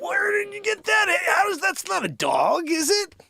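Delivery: loud, high mock astonishment; amazed stranger. Mimicking people shouting amazed at the dog's head. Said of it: "people are like".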